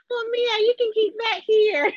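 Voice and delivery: a daughter hamming up her mother's voice; mom's voice. Retold or imitated. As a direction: play her mom, high-pitched and exaggerated. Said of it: "when I do my mom's voice".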